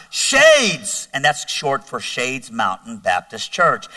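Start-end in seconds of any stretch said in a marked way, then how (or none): 0.1-1.1 high, drawn-out holler; imitated yell. Buddy answering the father from down the hall, imitated yell. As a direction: call it out down the hall, acted. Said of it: "he yells out".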